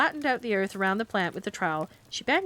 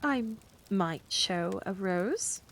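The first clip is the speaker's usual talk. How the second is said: hesitant; much slower than usual. Acted. Miss Price hesitates, blushing.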